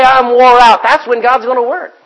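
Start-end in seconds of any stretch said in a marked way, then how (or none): 0.0-0.8 mock weary complaint. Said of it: "don't say".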